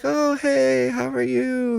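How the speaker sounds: high, sing-song mock greeting; friendly greeting. Staff greeting a favourite customer, high sweet voice. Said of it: "they're like".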